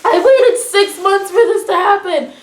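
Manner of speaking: high, loud, overjoyed mock scream; excited screaming. Retelling a long wait for an earthquake, excited screaming. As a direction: reenact squealing at a long-awaited thrill. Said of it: "like, screaming".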